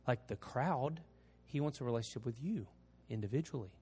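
soft, low invitation; preaching altar call. Acted. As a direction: speak it gently over music. Closing altar call prayer.

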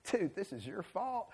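lightly voiced hippie, soft and slow; laid-back hippie dude. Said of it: "that laid-back hippie dude".

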